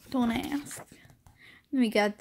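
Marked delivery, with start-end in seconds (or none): 0.1-0.8 whispered aside. Real, not acted. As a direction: breathe it without voice. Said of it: "unvoiced aside".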